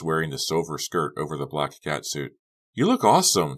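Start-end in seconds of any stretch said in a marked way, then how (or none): 2.7-3.6 high, loud praise; exclaiming. A narrator's reading. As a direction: gush over her outfit. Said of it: "Trish exclaimed".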